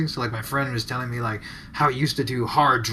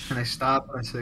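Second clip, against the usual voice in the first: ordinary talk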